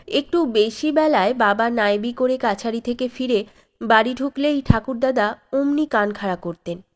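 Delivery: usual speaking voice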